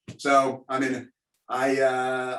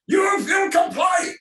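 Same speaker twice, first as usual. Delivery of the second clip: put-on high, slow, shouted German-accented order; mock German command; 5 dB louder, slower than usual. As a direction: shout it like a cartoon German officer. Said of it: "we all grew up with the German accents".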